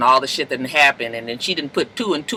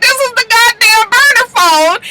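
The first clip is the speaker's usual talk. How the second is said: very high, loud mock alarm; shocked realisation; 5 dB louder than usual. [name] finding the phone in the office, shocked realisation. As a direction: blurt it out in rising panic.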